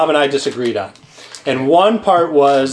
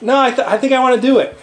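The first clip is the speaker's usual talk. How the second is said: quick, higher mimicked insistence; Bob insisting; 6 semitones higher, much faster than usual. Retelling Bob's stubborn answer, insisting. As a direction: lightly imitate a colleague digging in, fast.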